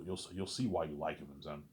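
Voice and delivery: normal talking voice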